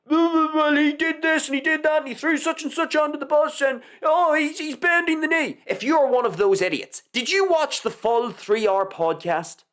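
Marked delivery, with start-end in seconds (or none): 0.1-5.6 high-pitched mock whine of online critics. Podcaster parroting critics' garbage rhetoric, high mocking voice. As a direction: mimic them in a silly, raised voice. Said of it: "carrying out this garbage rhetoric of".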